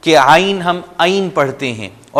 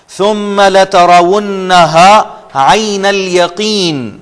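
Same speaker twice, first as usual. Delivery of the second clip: very slow, higher chanted recitation; Quranic recitation; 4 semitones higher, 3 dB louder, much slower than usual. Shown as a demonstration. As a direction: stretch each word like tajweed. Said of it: "پھر سے اس کو ہم دہرا لیتے ہیں".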